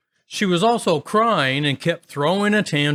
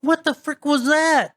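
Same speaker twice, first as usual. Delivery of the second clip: much higher, panicked squeal; startled sister; 12 semitones higher than usual. Retold or imitated. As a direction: squeal in sudden alarm. Scared sister hears strange voice.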